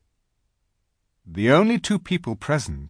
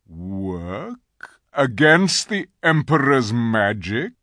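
stern lion, slow and swelling; frowning lion; 3 semitones higher, 6 dB louder, slower than usual. A narrator's reading. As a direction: question it slowly with stern disbelief. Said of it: "something like a frown on his face".

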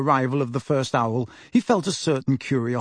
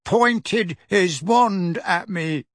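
put-on very slow, higher-pitched mumbling boy; mumbled; 5 semitones higher, much slower than usual. A narrator's reading. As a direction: drag the words out in a dazed mumble. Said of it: "Dudley mumbled".

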